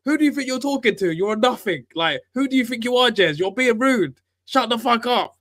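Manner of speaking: high, scornful mock telling-off; mock angry rant. Retelling Stricto putting the older fan down, mock scorn. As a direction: talk down to him, hammed up. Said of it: "And Stricto was like".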